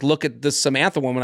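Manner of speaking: ordinary talk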